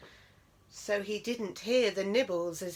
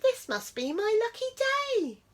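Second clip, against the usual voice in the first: delighted; 14 semitones higher, 5 dB louder than usual. A narrator's reading. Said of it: "said Mouse, delighted".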